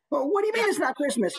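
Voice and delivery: quick, high mock protest; imitating an indignant character. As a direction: echo the character's fast, high disbelief. Host echoes the performed character line.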